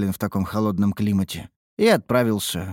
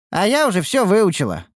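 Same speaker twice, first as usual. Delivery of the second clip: put-on proud, raised girl's voice; bragging; 11 semitones higher, 4 dB louder than usual. A narrator's reading. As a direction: show off to the master. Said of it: "похвасталась Ниуру".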